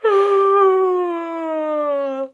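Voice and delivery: loud long high yawn; sleepy yawn. Acted. Dog yawning sleepily at Pony, loud. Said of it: "as sleepily as he possibly could".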